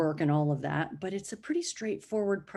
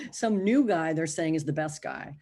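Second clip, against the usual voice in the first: ordinary talk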